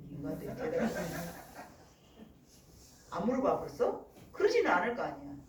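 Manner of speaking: usual speaking voice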